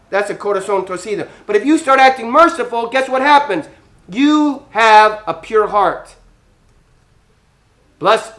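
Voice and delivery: normal talking voice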